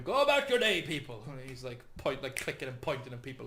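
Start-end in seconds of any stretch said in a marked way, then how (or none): 0.0-1.2 pompous loud proclamation; proclaiming loudly. Acted. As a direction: announce it to the street. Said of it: "he's just going".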